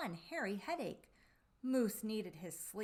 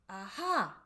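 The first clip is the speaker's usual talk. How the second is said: a loud, bright Aha of discovery; eager discovery; 8 dB louder than usual. Acted. Moose cries out, loudly pleased with his new idea. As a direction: burst out with the idea.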